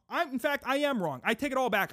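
normal talking voice

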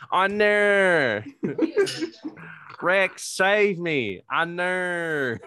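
loud, high mocking Australian kid voice, laughing through it; high, mocking Australian kid's whine; Australian accent imitation. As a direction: ham up a whiny Australian child accent. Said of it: "her accent".

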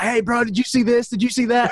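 high, eager nagging voice; mocking excited buddy. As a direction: imitate a friend pestering you nonstop. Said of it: "And he would be like".

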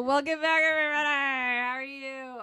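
big booming welcome to listeners; loud show intro. Acted. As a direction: greet the audience at full volume. Podcast host opening the episode.

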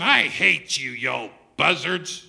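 loud acted outburst, last word drawn out; mock angry yell. Preacher imagining Jesus cursing his mockers, mock fury. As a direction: yell it as hammed-up hatred. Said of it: "Shouldn't he have been up there saying".

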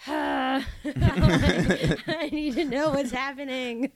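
loud, high, drawn-out panicky wail; hammed-up desperate cry breaking into laughter; mock anxiety. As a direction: act out an anxious freak-out, laughing. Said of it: "I give myself anxiety over it".

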